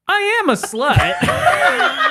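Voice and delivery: high, slow, drawn-out proud announcement; hammed-up tipsy high voice; mock drunk declaration.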